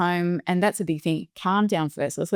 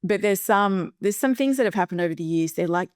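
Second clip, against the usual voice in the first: ordinary talk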